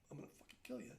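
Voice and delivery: very quiet, swear word barely voiced; hushed retold threat. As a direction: retell a threat under your breath.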